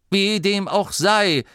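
high, leisurely contentment; satisfied. A narrator's reading. Driver dismisses the whole affair, satisfied. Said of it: "sagte Angelo zufrieden".

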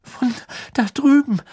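trembling. A narrator's reading. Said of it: "sagte Hermine zitternd".

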